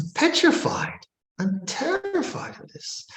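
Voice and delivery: high, frightened little voice; imitating fear. Monk imitating frightened man describing devil, high pitched. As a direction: mimic a scared man.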